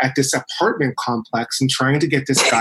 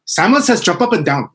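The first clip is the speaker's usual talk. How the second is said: mock island-accented Simon Says, pitched up; playful imitation of a put-on island accent; 6 semitones higher, 3 dB louder than usual. Wife keeping neighborhood kids busy, mock island accent. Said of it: "she's got this, like, strong, like, accent, this island accent".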